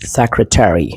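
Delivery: SECRETARY with every syllable, "SEC-ruh-TERRY"; American pronunciation. Shown as a demonstration. Teacher checks students are getting it, American pronunciation. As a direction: end secretary on a full "TERRY". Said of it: "American इसको".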